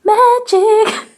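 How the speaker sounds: high, loud "Magic" with a rising end. Pretend. Vlogger repeating it playfully on camera, high magic. Repeating a word two ways.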